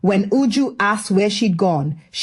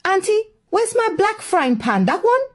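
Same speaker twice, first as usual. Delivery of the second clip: alarmed question; 11 semitones higher than usual. Acted. Uju finds her pan gone.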